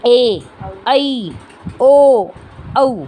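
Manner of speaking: "ए, ऐ, ओ, औ" each held for two beats; long vowels. Shown as a demonstration. Teacher listing the long vowels, each of "ए, ऐ, ओ, औ" held. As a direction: sound out "ए, ऐ, ओ, औ" one by one, lengthened. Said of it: "ह्रस्व स्वरों से दुगना".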